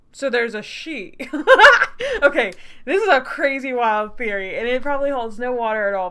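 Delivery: laughing excitement. Real, not acted. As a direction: giggle into an excited theory. Excited laughing about game theory.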